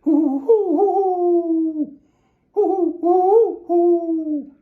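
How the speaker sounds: loud, high hooting owl cry; owl call. Acted. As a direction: call out through the woods like an owl. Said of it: "and then Pa called".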